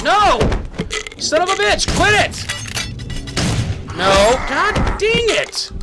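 very high, loud outburst; frustrated yelling. Real, not acted. Player killed by a game enemy, frustrated yelling. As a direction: shout in exasperation at losing.

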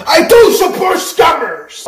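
shouting. Real, not acted. Shouted slogan.

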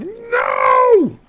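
loud, high drawn-out shout; mock-horrified yell. Player reenacting yelling at his son, loud high wail. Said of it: "like, yell at you".

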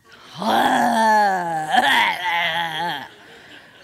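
long hammed-up cry of aversion; loud mock wail; loud exasperated groan. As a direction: let out a big, drawn-out yell of annoyance. Exasperation after imitating the neighbor.

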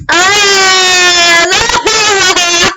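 loud, slow wail of protest; real cry of dismay. Real, not acted. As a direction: wail as the game beats you. Sendo derrotado no jogo, gritando.

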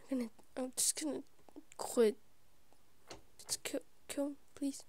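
hushed, almost whispered frustration; defeated muttering. Real, not acted. Player about to quit the game, quietly exasperated. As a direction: mumble it softly to yourself, giving up.

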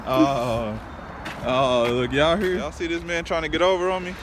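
ordinary talk

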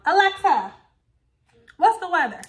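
loud, high call across the room to the speaker. Real, not acted. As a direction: raise the voice to reach the device. Calling out to smart speaker.

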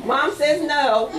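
laughing as she speaks. Real, not acted. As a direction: chuckle through the words. Laughing at child.

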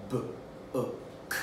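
"B-o-k" as three separate soft sounds; soft, segmented "B-o-k"; sounding out. Shown as a demonstration. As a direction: break "B-o-k" into isolated phonemes with gaps. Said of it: "It's time to sound out some words".

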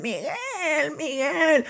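high shrill calls of a star's name; screaming fan girls. Retold or imitated. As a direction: imitate little girls screaming for their idol. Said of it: "gritando, gritando, gritando".

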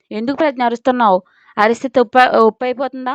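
usual speaking voice

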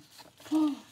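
loud startled gasp; sharp gasp. Acted. Narrator reacting to the crash, gasping. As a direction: catch your breath at the crash.

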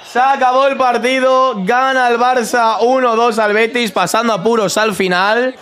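high, slow, joyful announcing; delighted. Real, not acted. Winning the pool, delighted.

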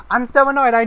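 high, loud frustrated cry; frustrated outburst. Real, not acted. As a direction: vent the frustration loudly. Frustrated at game mishap.

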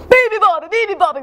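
loud, high roared chant; roaring. Acted. Lion waking the sleeping bird, roaring. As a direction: roar the nonsense words out. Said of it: "roared the lion".

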